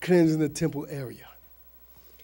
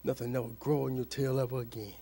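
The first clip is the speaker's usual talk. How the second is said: low, hushed curse; Jesus pronouncing a curse; 7 semitones lower, 14 dB quieter than usual. Retold or imitated. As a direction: pronounce judgment softly and very low. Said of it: "And he said".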